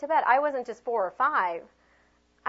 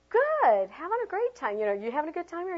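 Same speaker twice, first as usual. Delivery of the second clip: high, gushing small talk; acted over-friendly pep; bluffed cheeriness; 9 semitones higher, 4 dB louder than usual. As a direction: chatter brightly as if you know them.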